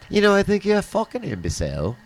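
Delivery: fast, prim English cursing; posh-sounding English put-down, lightly done; proper English accent. Retold or imitated. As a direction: sound proper while insulting. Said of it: "it just sounds so proper".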